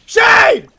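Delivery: high, full-volume yell of a name; furious scream. Real, not acted. Player to absent Shade teammate, furious scream.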